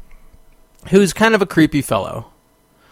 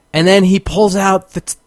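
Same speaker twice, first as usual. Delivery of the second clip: usual speaking voice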